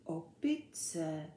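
small bewildered question; confused. A narrator's reading. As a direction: repeat it softly, at a loss. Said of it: "opakoval Ferda zmateně".

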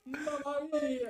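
high, slow Italian exclamation; italian accent portuguese. Pretend. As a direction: cry it out like a cartoon Italian. Italian caricature banter.

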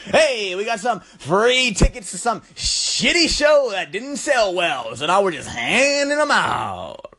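bright, hyped morning radio host. Acted. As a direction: pitch it up like a wacky morning DJ. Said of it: "Just talk like a fucking radio D J".

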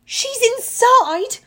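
high, loud, slow cry; horrified, stretched-out exclamation. A narrator's reading. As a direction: cry out in alarm. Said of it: "Zoe looked horrified".

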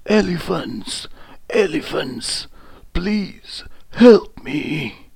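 high, drawn-out plea for help; trapped lion calling high for rescue; pleading call. A narrator's reading. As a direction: call out to passers-by, begging.

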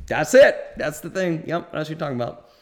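high, loud, thrilled yes; excited agreement. Retold or imitated. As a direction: jump in with eager agreement. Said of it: "I was like".

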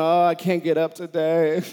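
mock sleepy grumble about Mondays; groggy complaining. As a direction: moan like someone who can't get up on Monday. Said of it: "stay in bed going".

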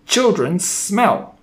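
slow, high witch cry; high-pitched, disgusted screech; screamed. A narrator's reading. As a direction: draw it out with loathing. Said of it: "she screamed".

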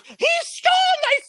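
very high, loud shriek; hammed-up hysterical scream; screamed accusation. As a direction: re-enact a screaming accusation at full pitch. Retold shouting accusation at bystanders.

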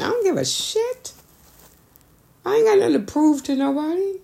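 high-pitched, defiant I don't give a shit. Real, not acted. Telling people she owes nobody proof, high and defiant. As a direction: toss it off, totally unbothered.

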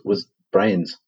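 ordinary delivery

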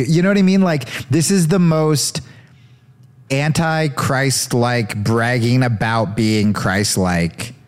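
normal talking voice

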